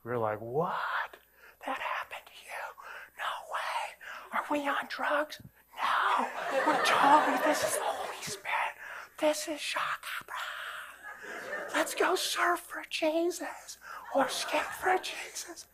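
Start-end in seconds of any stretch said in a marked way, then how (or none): 0.4-4.3 much softer, whispered amazement; excited whisper. Retold or imitated. Buddies marvel after prayer. 4.3-15.6 much higher, laughing and hushed; giddy laughing friends. Retold or imitated. Buddies thrilled, laughing about Holy Spirit.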